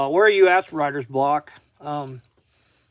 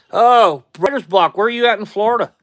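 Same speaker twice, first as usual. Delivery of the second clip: loud, high burst of recognition; excited surprise; 6 semitones higher, 15 dB louder than usual. Real, not acted. Streamer spots a viewer is from Florida, excited surprise. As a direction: call out excitedly, much louder and higher.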